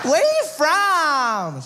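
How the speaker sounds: put-on high, slow airy California girl; valley girl voice. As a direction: play the ditzy Malibu surfer girl. Surfer girl asks.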